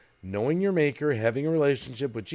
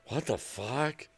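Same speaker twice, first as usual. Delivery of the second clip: soft, slow, disbelieving mutter; imitating a shocked son under his breath; quiet mock disbelief; stunned murmur; 6 dB quieter, slower than usual. Son's stunned reaction, retold.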